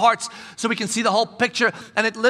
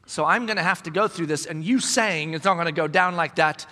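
ordinary delivery

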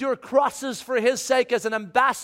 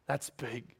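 unvoiced, much softer; whispered awe; 10 semitones lower, 15 dB quieter than usual. Real, not acted. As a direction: breathe it with awe. Awed closing remark.

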